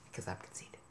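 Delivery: breathy whispered confession; self-mocking whisper to camera; whispered aside. Real, not acted. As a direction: mutter it under your breath.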